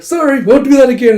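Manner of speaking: high, loud hurried sorry; flustered quick apology. Retold or imitated. Dragons caught interrupting the bath, flustered. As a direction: blurt it out while backing off.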